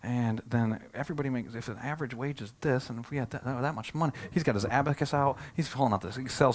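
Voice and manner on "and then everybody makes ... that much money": much softer, counting it up under the breath; quietly tally the numbers to yourself; inner thinking. Retold or imitated. Pastor voicing Philip's private doubts, much softer. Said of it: "And Philip starts thinking".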